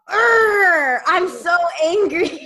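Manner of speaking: high, loud, slow growly whine; mock angry cartoon tiger, high and loud. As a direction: ham up a cartoon tiger's tantrum. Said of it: "He goes".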